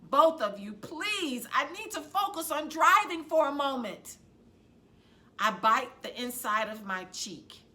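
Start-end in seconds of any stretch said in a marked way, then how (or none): 0.1-4.2 louder, higher exasperated plea; a tired mother finally boiling over; exasperated, barely contained anger; losing patience. A narrator's reading. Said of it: "Mom can't contain it".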